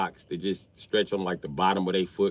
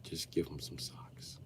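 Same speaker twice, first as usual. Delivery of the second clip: hushed whispered aside; dad whispering to daughter to give socks; 4 semitones lower, 16 dB quieter, slower than usual. Retold or imitated. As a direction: lean in and whisper it.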